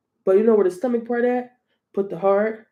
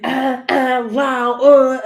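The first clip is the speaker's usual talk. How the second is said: hammed-up attention-seeking noises; attention seeker; 2 semitones higher, 6 dB louder, much slower than usual. Reader mocking attention-seeking Leos, hammed-up fussing. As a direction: mimic someone making a fuss for attention. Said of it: "Attention seeking".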